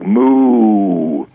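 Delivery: cow moo. Retold or imitated. Cow in the retold commercial.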